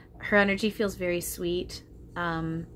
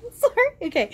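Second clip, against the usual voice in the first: high, emotional laugh then apology; teary laugh; 9 dB louder than usual. Real, not acted. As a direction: laugh it off through tears. Overwhelmed emotion again.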